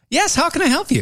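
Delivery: chirpy receptionist. Retold or imitated. Imitating a school receptionist answering a caller. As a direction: put on a bright helpful receptionist voice. Mock customer service greeting.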